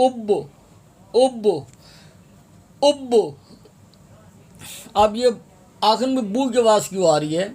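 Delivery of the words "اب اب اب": اب repeated with a bounced ب, pitch climbing; qalqala demo, rising. Shown as a demonstration. Teacher drilling the stop, rising bounced ب. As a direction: echo the ب of اب, higher each time. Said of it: "آگے وقف جب بھی ہوں گے".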